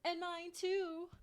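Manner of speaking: slow, high, loud shout back. A narrator's reading. Angry girl shouts back at her friend in the tree. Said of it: "Kishi shouts back".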